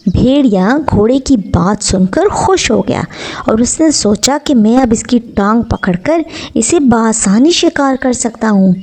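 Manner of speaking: ordinary delivery, nothing marked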